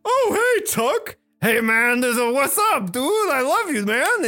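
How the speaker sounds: high, loud, gushing greeting; effusive cat host. Acted. Cat host greets his old friend, high and effusive. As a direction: gush over an old buddy in a high showman voice.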